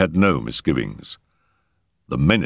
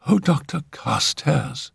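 high, drawn-out female appeal; distressed cry; 9 semitones higher than usual. A narrator's reading. Phyllis appealing to the doctor upstairs, high distressed cry. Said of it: "she cried".

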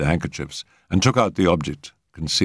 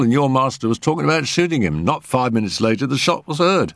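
chatty constable; 5 semitones higher than usual. Acted. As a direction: rattle off the story eagerly. Talkative constable voiced.

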